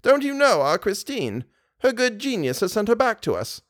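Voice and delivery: put-on high-pitched old lady voice; fond old adoptive mother. Old lady tells visitor of Christine's return, high and eager. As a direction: voice a trusting old woman, pitched well up.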